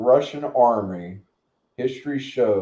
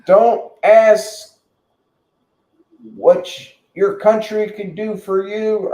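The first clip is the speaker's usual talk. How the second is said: mock presidential oration; 11 semitones higher, 7 dB louder, slower than usual. Reciting Kennedy's famous speech.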